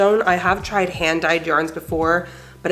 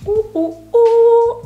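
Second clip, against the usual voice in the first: much higher, rising squeals of delight; delighted ooh; 7 dB louder, much slower than usual. Real, not acted. Crafter seeing the top on herself, high excited squeals. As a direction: squeal at the mirror.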